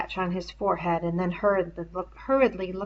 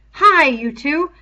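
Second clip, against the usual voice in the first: calling out; 8 semitones higher, 11 dB louder, slower than usual. A narrator's reading. Ralph calls to the twins.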